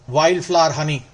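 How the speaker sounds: usual speaking voice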